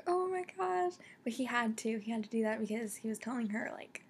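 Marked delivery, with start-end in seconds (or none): 0.1-0.9 high, startled oh my gosh; shocked gasp. Retold or imitated. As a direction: jump up in pitch, caught off guard. Retold shock at ear bleeding.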